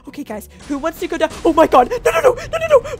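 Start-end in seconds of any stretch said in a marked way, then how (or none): 1.4-3.0 much higher, louder frantic no's; shrieking as the ghost darts past; panicked. Real, not acted. The ghost runs toward her bedroom, much higher frantic no's.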